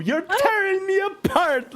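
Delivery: high, slow, shouted outburst; Tommy Wiseau impression. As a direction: yell it like a melodramatic wreck. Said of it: "that clip from, like, the, the Room".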